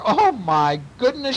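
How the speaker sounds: high, drawn-out exclamation; astonished. A narrator's reading. Aunt stares at the burglar, astonished. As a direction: marvel in storybook amazement.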